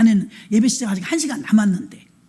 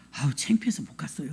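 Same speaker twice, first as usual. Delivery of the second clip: embarrassed whisper; 7 semitones lower, 9 dB quieter than usual. Retold or imitated. Embarrassed parishioner quoted.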